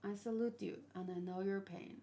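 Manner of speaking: normal talking voice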